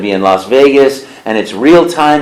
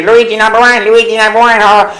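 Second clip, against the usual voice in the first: higher, proud, nasal-free cartoon Italian boasting; Luigi voice; 9 semitones higher, 3 dB louder than usual. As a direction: Luigi bragging he is number one. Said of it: "then there's Luigi".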